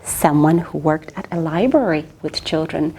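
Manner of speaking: usual speaking voice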